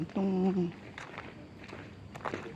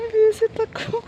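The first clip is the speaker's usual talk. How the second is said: much higher, louder burst; retold exclamation; 8 dB louder, slower than usual. Boss blurting Visit ako, loud and high. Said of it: "sabi niya".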